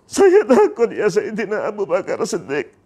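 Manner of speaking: acted tearful plea, starting at its peak; crying in fear. Bilal pleading to Abu Bakar, tearfully afraid. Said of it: "mengapa kamu menangis sampai seperti ini".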